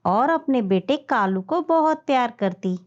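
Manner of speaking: ordinary delivery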